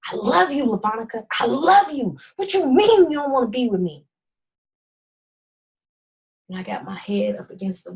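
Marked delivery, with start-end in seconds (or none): 0.0-4.1 mock scream. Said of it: "he's screaming at me".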